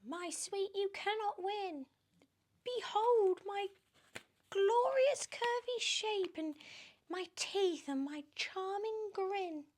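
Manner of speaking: very high, sneering witchy voice; high mocking hag voice; spiteful Aunt Spiker. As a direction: jab at her sister with a high sneer. Aunt Spiker boasting back.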